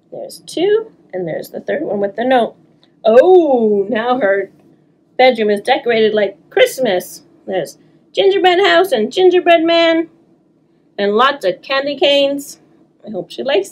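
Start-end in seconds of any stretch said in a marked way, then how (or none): none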